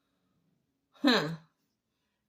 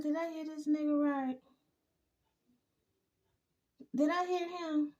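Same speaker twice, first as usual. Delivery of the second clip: stunned hushed disbelief; 5 semitones higher, 7 dB quieter, faster than usual. Retold or imitated. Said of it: "I said".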